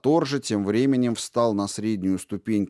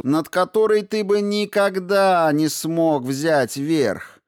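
mocking taunt; 6 semitones higher than usual. Princess taunts Ator scornfully.

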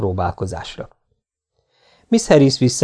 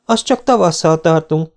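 a mild, lightly voiced reproof, pitched higher; reproving; 6 semitones higher than usual. A narrator's reading. Shopgirl correcting an odd customer, mildly reproving. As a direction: set him straight politely. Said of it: "rendre utasító hangon".

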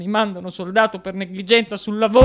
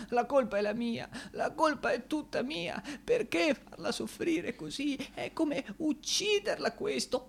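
despairing lament; 4 semitones higher, 10 dB quieter than usual. Acted. Despairing over his beloved's fate.

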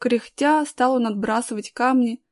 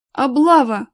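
louder, narrated outburst; excited exclamation; 3 semitones higher, 8 dB louder, slower than usual. A narrator's reading. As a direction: exclaim it as a sudden alert. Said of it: "воскликнул Колян". (взволнованный возглас)

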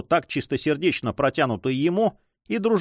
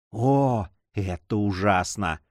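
slow, lower-pitched murmur of sorrow; sorrowful whisper; 3 semitones lower, slower than usual. A narrator's reading. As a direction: say it slowly, with grief. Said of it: "прошептали они с горестью". (горестно, вполголоса)

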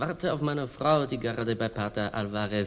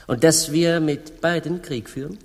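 laut; 5 semitones higher, 6 dB louder than usual. Acted. König und Kolumbus streiten.

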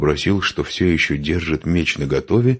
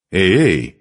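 louder, higher protest; exclaiming; 4 semitones higher, 6 dB louder than usual. A narrator's reading. Man dodging the woman's slap, exclaiming. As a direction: cry out to fend her off. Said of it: "воскликнул он".

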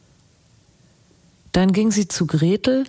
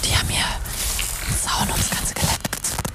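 hushed whisper, sneaking in at night; 6 dB quieter than usual. Acted. Sneaking onto the fenced-off dig site at two in the night, whispering. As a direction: whisper so no one catches you.

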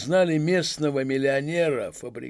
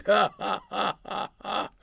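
slow, hearty mocking laughter; delighted guffaw; 7 semitones higher, slower than usual. Masked man laughing at the policeman, delighted guffaw. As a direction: laugh in his face with pleasure. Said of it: "захохотал от удовольствия".

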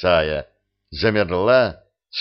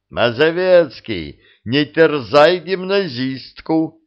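drawn-out, higher mocking call; teasing jibe; 6 semitones higher, 4 dB louder, slower than usual. A narrator's reading. Sergeant jeering at his comrade's failed courting, teasing. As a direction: taunt him with slow, stretched words. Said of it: "возможности поиздеваться над очередным".

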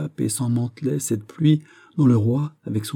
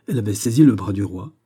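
plain reading voice

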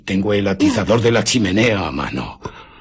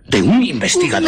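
indignant outburst; 3 dB louder, faster than usual. Acted. Invisible man indignant.

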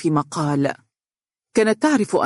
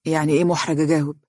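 quick, puzzled question; understated surprise; mildly surprised; much faster than usual. A narrator's reading. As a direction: ask it fast, a touch taken aback. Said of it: "رفع حاجبيه بدهشة وقال".

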